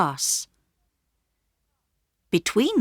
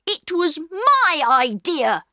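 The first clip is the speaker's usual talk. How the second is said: much higher sharp denial; angry boy; 10 semitones higher, 4 dB louder, slower than usual. A narrator's reading. Peter to Bobby, angrily denying. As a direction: cut his sister off. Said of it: "said Peter angrily".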